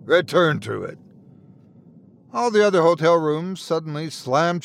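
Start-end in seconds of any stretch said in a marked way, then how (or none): 0.0-1.0 growled command. Acted. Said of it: "the voice growled".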